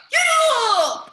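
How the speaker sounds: big loud high shout; playful shout. Real, not acted. Coach challenges kids to yell loudest, shouting. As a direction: point and shout to fire them up.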